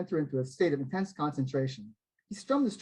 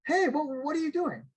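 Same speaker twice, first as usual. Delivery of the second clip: put-on higher, panicked cry of a startled teen; higher, panicked yelp of a fan fearing a mugging; 11 semitones higher than usual. A narrator's reading. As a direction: yelp it in sudden alarm. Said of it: "Joel panicked".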